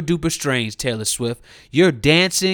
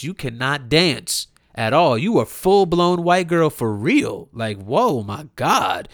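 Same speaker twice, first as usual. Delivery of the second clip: ordinary delivery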